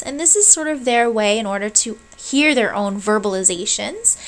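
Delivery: usual speaking voice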